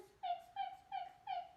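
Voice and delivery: tiny, very high-pitched squeaks; little mouse squeak. Pretend. Teacher saying hello like a mouse to children, squeaky. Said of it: "like a little mouse".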